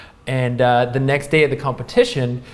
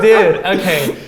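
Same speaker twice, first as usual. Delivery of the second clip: laughing mock exasperation; 6 semitones higher, 7 dB louder than usual. Laughing retold complaint about clothing.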